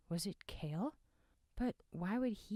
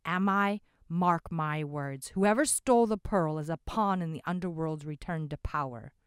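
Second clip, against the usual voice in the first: grim insistent warning; 10 dB louder than usual. Acted. Ominous old man insists.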